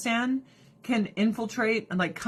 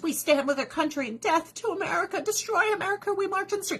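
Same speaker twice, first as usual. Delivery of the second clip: much higher chanting mock protester; 10 semitones higher than usual. Mocking Middle Eastern protesters, high chanting voice. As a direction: chant it like a zealous crowd. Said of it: "all these Middle Easterners".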